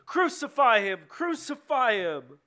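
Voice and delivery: high, loud crowd cry; insistent shouting. A narrator's reading. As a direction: keep shouting it, stretching the repeat. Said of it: "they kept shouting".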